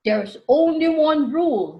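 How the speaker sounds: a touch of a loud announcer. Acted. Announcer tells the racers the rule, announcing. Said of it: "the announcer explained".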